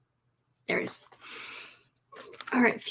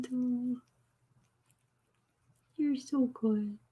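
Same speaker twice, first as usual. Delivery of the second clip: hushed cooing; slower than usual. Real, not acted. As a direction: whisper your adoration. Admiring the cat softly.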